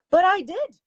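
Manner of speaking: high, loud exclamation; exclaimed. Acted. Companion defiantly answering her scolding employer, high loud exclamation. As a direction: fire it back, loud and high. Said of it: "Miss Grant exclaimed".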